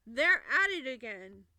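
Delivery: shouted at the top of the voice; sudden, very loud announcement. A narrator's reading. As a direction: bellow it out like a trumpet. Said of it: "he simply shouted out the top of his".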